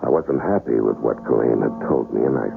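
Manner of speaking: low, even first-person storytelling; story narration. A narrator's reading. Narrator [name] setting up the breakfast scene, calm retelling.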